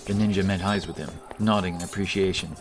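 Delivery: ordinary delivery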